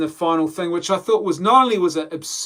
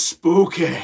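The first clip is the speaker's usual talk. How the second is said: mock-Scottish accent, louder; Scottish conspiracy theorist; 5 dB louder than usual. Vlogger quoting a conspiracy-minded Scotsman, mock Scottish accent. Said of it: "the Scotsman who's a bit of a conspiracy theorist".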